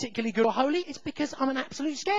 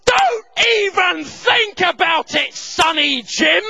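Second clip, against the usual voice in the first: loud, high, slow menacing shout; drawn-out shouted threat, re-enacted; East End gangster threat; 8 semitones higher, 10 dB louder, slower than usual. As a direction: warn him off like a gangster. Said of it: "I've turned into an East End gangster".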